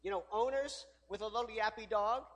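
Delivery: normal talking voice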